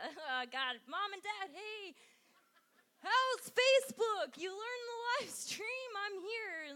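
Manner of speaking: high, loud hello to the parents; mock cheery greeting.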